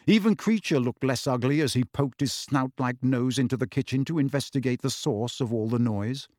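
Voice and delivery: ordinary delivery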